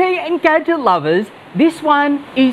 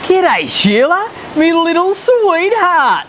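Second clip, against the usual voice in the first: mock Aussie; 3 semitones higher, slower than usual. Ash answers Opal, mock Aussie.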